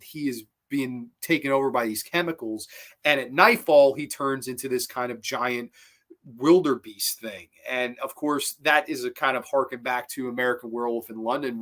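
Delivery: ordinary talk, nothing marked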